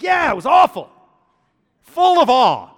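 high loud mock grandfather voice. Grandfather insisting to grandson the game was awful, loud mock voice.

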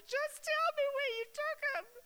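high, loud, distraught begging; pleading through grief for the body; tearful high cry of a grieving woman; desperate plea. Acted. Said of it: "You know, she's that upset".

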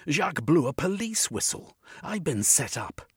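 high, loud insistence; urgent protest. A narrator's reading. Jeremy protests to the arresting policemen, loud. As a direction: make them listen.

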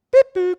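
high, loud mock beep-boop; robot beep noise. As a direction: make a silly robot signal sound. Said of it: "he didn't do that noise".

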